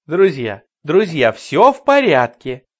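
loud, upbeat reassurance; cheerful announcement. Acted. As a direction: smile and calm the crowd. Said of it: "с бодрой улыбкой объявил".

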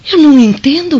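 can't make sense of it; bewildered. Acted. Wife to husband, startled and baffled.

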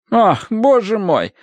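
slow, high-pitched dismay; alarmed. A narrator's reading. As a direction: fret in a high, drawn-out voice. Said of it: "подняла встревоженный взгляд". (встревоженно, высоко и протяжно)

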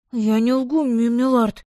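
frightened servant stumbling over милорд; trembling voice. Acted. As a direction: voice breaking as he swears he isn't lying. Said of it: "голос слуги дрогнул".

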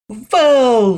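loud, high cry of delight; excited shout. A narrator's reading. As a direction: shout it with joy. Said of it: "закричал Лёшка".

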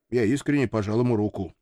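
ordinary delivery, nothing marked

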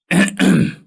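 loud, high deliberate throat clear; pointed throat clearing. Acted. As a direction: clear the throat to announce yourself. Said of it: "Cô ta hắng giọng".

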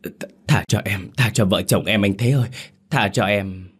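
acted begging, stammering at first; desperate plea, voiced by a narrator; pleading. A narrator's reading. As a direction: plead for mercy on your knees. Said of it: "Khang quỳ mọp xuống van xin".

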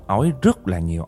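ordinary delivery, nothing marked

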